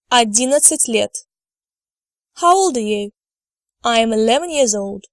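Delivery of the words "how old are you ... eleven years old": ordinary talk, nothing marked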